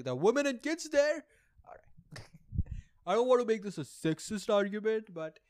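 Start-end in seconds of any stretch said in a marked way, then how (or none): 0.0-1.2 higher and louder, preachy tone; mock earnest. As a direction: say it like a sanctimonious slogan. Mocking a repeated talking point.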